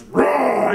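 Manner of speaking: hammed-up beast roar; monster roar. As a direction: roar like a basement heater come alive. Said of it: "the heater goes".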